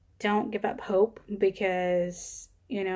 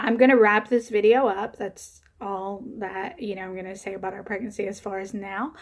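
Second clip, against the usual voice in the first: ordinary talk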